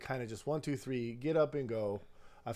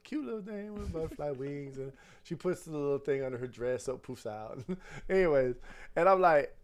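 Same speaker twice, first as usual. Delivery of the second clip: ordinary talk, nothing marked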